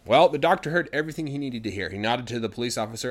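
ordinary delivery

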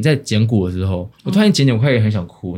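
usual speaking voice

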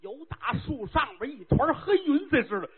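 normal talking voice

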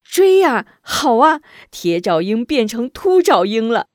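loud high jeering shout; gleeful crowd yelling; crowd cheering. Acted. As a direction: yell in triumph at the beaten bully. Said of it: "不由齐声呐喊".

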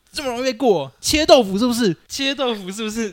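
high, loud scornful shout; angry scolding. Retold or imitated. Teammate points at my nose and scolds me, angry high shout. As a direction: yell it down at a teammate who got beaten. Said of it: "他就指着我鼻子说".

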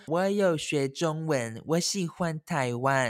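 put-on slow, careful foreign-accented Chinese; foreigner's Chinese. Korean coworker praising Taiwan in slow learner's Chinese. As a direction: spell out each word like a learner.

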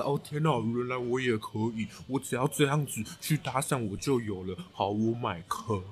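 mocking gullible guy. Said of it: "刚刚的那个嘴脸".

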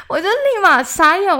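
high, loud, still reeling; stunned. Real, not acted. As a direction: sound dumbfounded.

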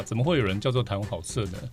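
ordinary talk, nothing marked

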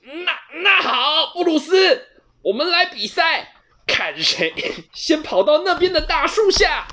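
high, loud stammering challenge; quick retort. A narrator's reading. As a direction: save face with a race. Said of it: "球球立刻反驳". (球球立刻高声反驳)

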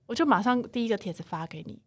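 usual speaking voice

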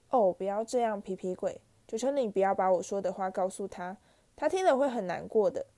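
plain reading voice

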